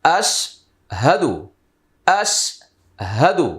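আশহাদু slowly stretched syllable by syllable; teacher modelling আশহাদু slowly; drawn-out recitation. Shown as a demonstration. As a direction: draw out আশহাদু with clear h. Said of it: "তাহলে উচ্চারণ কি হবে?".

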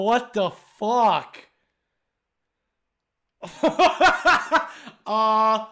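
ordinary delivery

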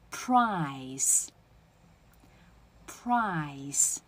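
price stretched slowly, full long I and clear final s; drawn-out model price, hissed s at the end; full vowel. Shown as a demonstration. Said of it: "你这个元音I也要饱满的啊".

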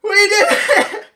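very high, loud victory cries; roaring victory celebration; triumphant screaming. Real, not acted. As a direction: scream it out at the win. Winning the rank.

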